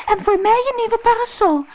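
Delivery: put-on very high-pitched girl's voice; girl calling out. A narrator's reading. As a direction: pipe up high with a demand. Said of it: "riep het mooie meisje". (hoog roepend meisje)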